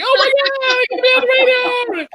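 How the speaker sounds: very high, loud re-enacted celebration yell; over-the-top, screamed joy; ecstatic victory yell. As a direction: shout it like you just won the title. Re-enacts Rocky victory dance.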